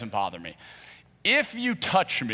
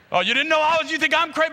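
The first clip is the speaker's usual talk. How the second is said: high, loud indignant cry; offended outburst; 13 semitones higher, 4 dB louder, faster than usual. Retold or imitated. Snapping at his brother for calling him crazy. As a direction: blow up at the word crazy.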